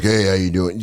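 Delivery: ordinary delivery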